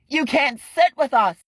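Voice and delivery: high, loud taunting chant; mean girl shout. Acted. Boys shouting Kent away from the cellar, high mocking yell. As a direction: shut him out with a sneer.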